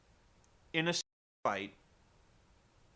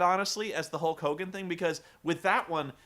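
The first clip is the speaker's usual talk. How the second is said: ordinary talk, nothing marked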